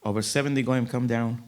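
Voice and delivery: ordinary delivery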